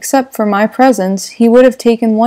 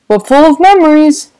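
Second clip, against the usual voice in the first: put-on higher, louder, wistful old gangster; Wolfsheim's voice; 6 semitones higher, 7 dB louder than usual. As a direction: sigh it out over old haunts. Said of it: "said Mister Wolfsheim".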